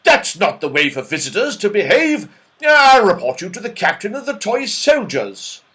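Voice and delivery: loud, offended scolding; indignant threat. A narrator's reading. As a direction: threaten to report them, still furious. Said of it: "in a rage".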